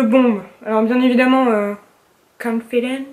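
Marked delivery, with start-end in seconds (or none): 2.4-3.1 English title in a clumsy French accent; stumbling over the English word; bad English accent. Shown as a demonstration. Reading an English song title, heavy French accent. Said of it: "super accent trop pourri".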